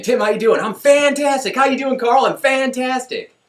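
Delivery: high and loud, bursting with cheer; exuberant exchange. Retold or imitated. Tim and Carl's daily greeting, high and exuberant. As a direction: trade greetings like a joyful ritual. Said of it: "until I'd walk in".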